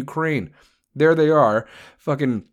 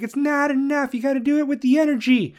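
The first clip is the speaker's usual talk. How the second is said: much higher, insistent voice; mock demanding public; 12 semitones higher than usual. Ranter imitating people demanding energy sanctions, high and insistent. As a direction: push like an impatient crowd. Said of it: "We're like".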